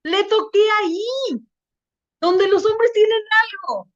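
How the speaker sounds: imitated loud girl. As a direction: call out the secret in her high voice. Girl loudly tells secret despite shushing.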